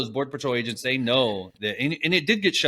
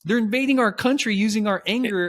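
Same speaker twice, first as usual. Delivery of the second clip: mock cheering; 8 semitones higher than usual. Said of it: "everybody's cheering him on".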